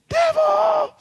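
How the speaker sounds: high, slow, full-force holler; commanding shout. Retold or imitated. Teen driving the devil out of his house, shouting slowly. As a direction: order the devil out, stretching each word loud. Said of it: "I began to shout".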